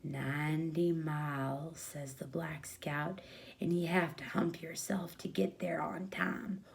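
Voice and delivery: a scout keeping his voice down; hushed kid voice. Acted. Kid answers Bill.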